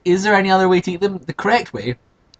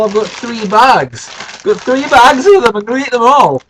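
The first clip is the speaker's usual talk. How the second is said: mock defiant; 7 semitones higher, 8 dB louder than usual. Defiant boast to viewers.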